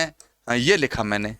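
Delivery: ordinary talk, nothing marked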